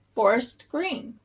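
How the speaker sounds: ordinary talk, nothing marked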